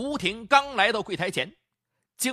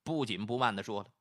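plain reading voice

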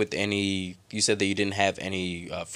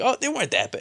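dismissive mock quote; faster than usual. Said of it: "be like".

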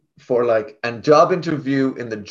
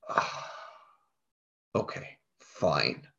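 resigned; 8 semitones lower, 13 dB quieter, much slower than usual. Retold or imitated. Resigned reaction to German job.

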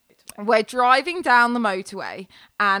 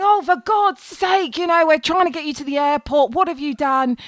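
higher-pitched, imitated exasperation; exasperated partner; 5 semitones higher than usual. Baby daddy scolding her on the airport drive, exasperated. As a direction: scold in mock exasperation, drawing out the opening.

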